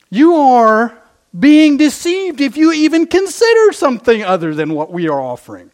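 browbeat the flock into fear; threatening Pharisee. Acted. Pharisees intimidating the officers, loud and menacing. Preacher voices scolding Pharisees.

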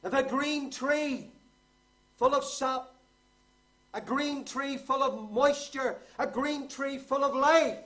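high, slow, loud pulpit cry; full-force preaching. Real, not acted. Preacher proclaims the green tree to congregation, full-force preaching. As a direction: thunder it out phrase by phrase, drawing words out.

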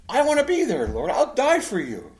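loud, high-pitched, slowing heartfelt pledge; earnest vow. Acted. Big fisherman pledging his life to his Lord, earnest vow. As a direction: insist with total sincerity. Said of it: "And he meant that".

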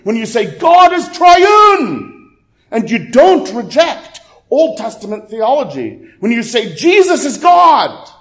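high, forceful preacher's proclamation, building to a shout; impassioned preaching. Real, not acted. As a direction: drive each point home to the congregation, pitch soaring.

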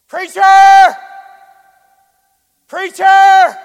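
loud, high call across a garage. Retold or imitated. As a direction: shout for the preacher from far off. Man calling across garage.

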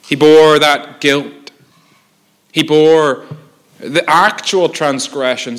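slow, loud, hammering each word; forceful pulpit emphasis on bore; loud emphatic preaching. Acted. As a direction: pound home "He bore that guilt".

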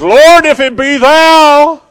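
very high, loud, drawn-out holler; bold shout as Peter. Acted. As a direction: belt it out slowly to the Lord across the water. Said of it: "Peter jumps up and says".

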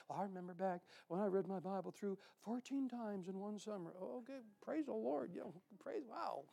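soft, mumbled mock reminiscence; hushed reminiscing. Believer recalls reading the Bible, soft mock musing. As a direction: tail off softly into old glories. Mimics believer reliving past glory.

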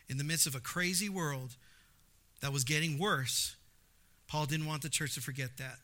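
ordinary delivery

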